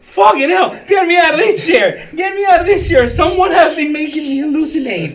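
screaming to be freed; frantic high yelling; panicked shouting. Acted. Captive Moses freed, panicking.

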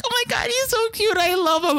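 high-pitched mock gushing; gushing imitation. Mimicking people who adore the pug, high gushing. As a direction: coo it as an exaggerated, high imitation.

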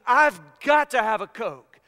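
loud, hammed-up desperation; urgent craving. Husband thinking out of a dead sleep, mock urgent. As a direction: punch out a mock-desperate thought. Retells his craving thoughts.

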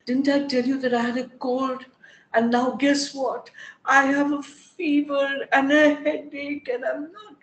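told-you-so complaint. Retold or imitated. Complaining to a friend that she was right about being ill. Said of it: "And I'll say".

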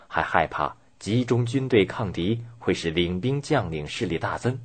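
ordinary delivery, nothing marked

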